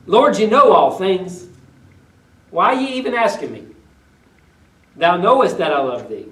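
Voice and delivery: a touch of sarcasm, turning it back on him; sarcastic. Acted. Peter throwing the question back at Jesus, sarcastic. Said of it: "a hint of sarcasm here with Peter".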